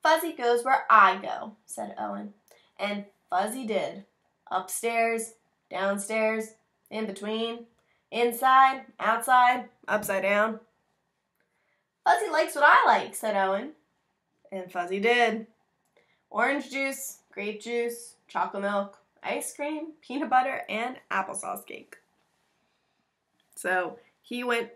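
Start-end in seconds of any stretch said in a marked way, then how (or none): 0.0-10.6 put-on reading to children. Reads picture book aloud. 12.0-13.2 put-on little boy voice. Owen's quote in story. 13.2-22.0 put-on reading to children. Reads picture book aloud.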